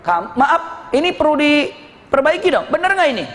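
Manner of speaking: high, challenging interjection, little added volume; imitating a doubter calling out; indignant mock shout. Witness interrupts the convert to question him, mock shouting.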